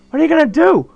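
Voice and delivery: alarmed. Acted. Kenji alarmed question.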